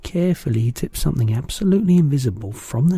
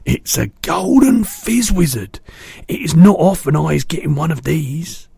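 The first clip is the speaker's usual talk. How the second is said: proud excitement; 6 semitones higher, 5 dB louder than usual. A narrator's reading. BFG boasting to Sophie, proud excitement. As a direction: show it off proudly.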